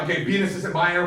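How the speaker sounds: ordinary talk, nothing marked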